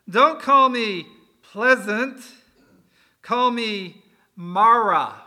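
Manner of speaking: put-on a wounded woman snapping back at her old neighbours; bitter retort. Naomi answers the town women, loud, slow and bitter. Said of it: "Pleasant's response wasn't so pleasant".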